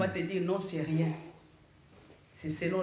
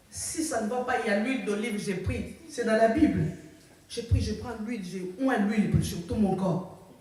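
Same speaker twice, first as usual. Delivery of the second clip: ordinary delivery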